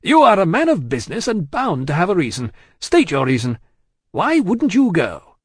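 loud, high, insistent; blustering courtroom demand; forensic cross-examination. A narrator's reading. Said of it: "forensically shaking a forefinger at him".

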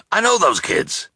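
high, eager shopkeeper cry; excited cry. Acted. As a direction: blurt it out excitedly. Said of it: "he cried".

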